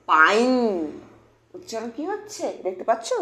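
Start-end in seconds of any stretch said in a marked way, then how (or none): 0.1-0.5 পাইন drawn out, one syllable with long 'ai'; correct pronunciation. Shown as a demonstration. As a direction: say পাইন like English 'pine', silent e. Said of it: "তাহলে কি হয়ে যাচ্ছে".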